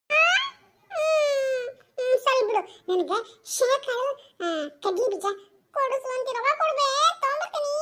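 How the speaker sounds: put-on high, whiny crying kid voice; whimpering child. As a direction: whimper like a sulking little boy. (ಅಳುವ ಮಗುವಿನ ಕೊರಗು)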